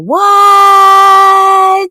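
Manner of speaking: high, loud stunned shout; shocked what. Real, not acted. Host shocked by the tea cure claim, shouting. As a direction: hit it like you can't believe your ears. Reacting in disbelief to clip.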